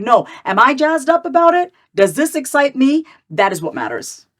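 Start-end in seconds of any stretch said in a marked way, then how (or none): 0.0-3.0 higher, eager inner voice; excited self-talk. Retold or imitated. Coaching herself toward the offer, higher and eager. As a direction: ask yourself with real eagerness. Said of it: "It's like".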